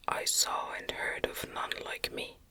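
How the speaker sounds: plain reading voice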